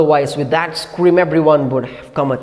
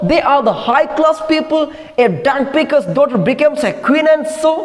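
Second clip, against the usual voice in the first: higher, bitter mocking comeback; sarcastic retort; 7 semitones higher than usual. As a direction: sneer back at her. Husband retorts in quarrel.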